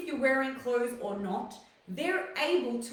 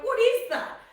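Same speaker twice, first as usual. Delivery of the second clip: mock shocked shriek, higher and louder; shocked dad; 9 semitones higher, 6 dB louder than usual. Retelling dad's reaction to the outfit, high mock shock. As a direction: play the dad gasping at the outfit. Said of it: "He's like".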